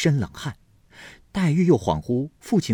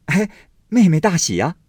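put-on high, loud, gleeful young man; cheerful congratulation; 9 semitones higher, 7 dB louder than usual. A narrator's reading. Baoyu gleefully congratulates Daiyu, high and loud. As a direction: call out the happy news brightly. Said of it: "笑嘻嘻地说".

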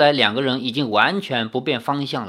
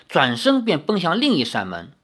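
plain reading voice

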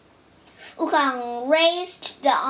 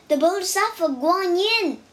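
amazed cry; 5 semitones higher, faster than usual. A narrator's reading. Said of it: "cried the Tang monk".

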